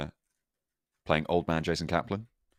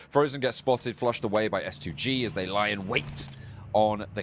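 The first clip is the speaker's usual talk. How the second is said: esports caster calling the action; fast, energetic caster read; live play-by-play; 4 semitones higher than usual. Acted.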